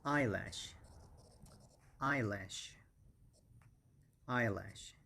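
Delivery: eyelash with EYE stressed, falling; stress on the first syllable. Shown as a demonstration. Teacher drills eyelash for students, first syllable stressed. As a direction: hit EYE in eyelash, then let lash fall. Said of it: "the stress is on the first syllable".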